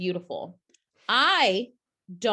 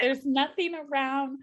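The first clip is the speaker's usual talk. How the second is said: mock despair; 8 semitones higher, slower than usual. Quoting her own helpless complaint.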